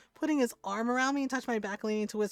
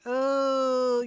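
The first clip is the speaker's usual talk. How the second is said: stunned oh; 5 semitones higher, 9 dB louder than usual. Retold or imitated. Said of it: "and I went".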